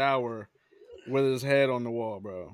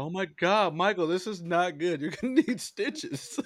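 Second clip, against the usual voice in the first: higher, laughing imitation of a panicked girlfriend; mock freak-out; 5 semitones higher than usual. Imitating his girlfriend panicking over his head wound, higher and laughing. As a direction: play her panic, breaking into a laugh. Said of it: "She was freaking out".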